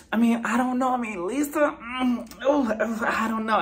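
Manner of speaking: high, hesitant mock hemming; Sutton. Imitating a friend dodging the question, hesitant. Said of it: "Sutton is just like".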